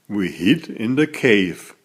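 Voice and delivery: usual speaking voice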